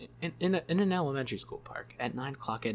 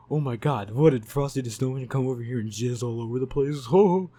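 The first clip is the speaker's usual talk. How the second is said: stoned guy joke; 2 semitones higher, 9 dB louder than usual. Retold or imitated. Stoned man joking about snow to his friends, goofy loud voice. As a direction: ham up the stoned guy cracking a dirty joke.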